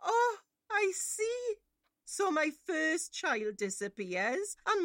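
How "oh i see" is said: high, slow, crying bitterness; tearful sarcasm. Acted.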